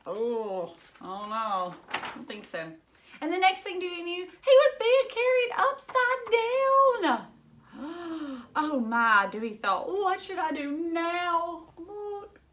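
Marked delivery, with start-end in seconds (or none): 3.2-7.3 dramatize the indignity; mock-dramatic exclaim; alarmed high voice. A narrator's reading. Dramatic peril. 8.6-11.7 mild cat fret. A narrator's reading. Dewey worried.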